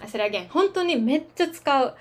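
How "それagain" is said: fast aside. Real, not acted.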